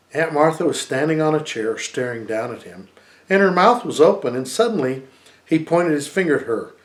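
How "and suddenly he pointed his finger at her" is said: ordinary delivery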